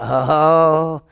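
loud, drawn-out group "ooh"; crowd's ominous "oh". Retold or imitated. Crowd reacting as Jesus stops, loud drawn-out oh. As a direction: react like someone's in trouble. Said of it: "everybody went".

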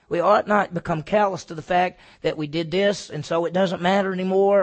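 usual speaking voice

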